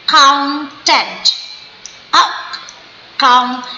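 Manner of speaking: Accountant broken as ak... coun... tent; slow syllables. Shown as a demonstration. Teacher demonstrating jaw drops, accountant slowly split. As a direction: leave gaps between the syllables of accountant. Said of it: "slow slow करके बोलिए".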